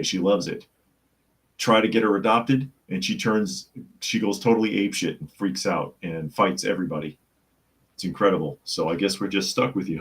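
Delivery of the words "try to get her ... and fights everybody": normal talking voice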